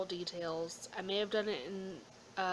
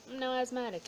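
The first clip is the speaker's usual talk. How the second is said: ordinary talk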